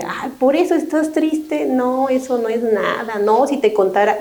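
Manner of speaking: high, incredulous brush-off; mock friend belittling the problem; dismissive scoff. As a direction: wave away their sadness as nothing. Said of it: "se le quita el protagonismo a la persona".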